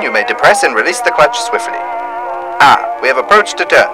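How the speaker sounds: ordinary talk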